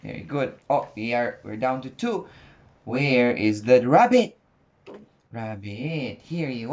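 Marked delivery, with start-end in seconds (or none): none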